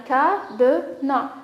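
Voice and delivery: "cadenas" slowly as ca, de, nas; split into syllables. Shown as a demonstration. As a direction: separate the three beats of "cadenas". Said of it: "les syllabes qu'on va choisir".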